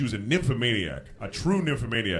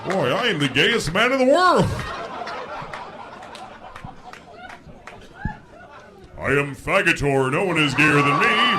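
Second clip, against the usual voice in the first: grand proclamation; 4 semitones higher than usual. Acted. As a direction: proclaim it like a hammed-up hero. Acts out a grand self-realization.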